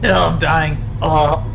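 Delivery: gasping out words after a coughing fit; coughing up water. Real, not acted. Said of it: "water went down the wrong pipe".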